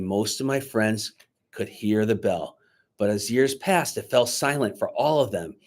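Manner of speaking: ordinary delivery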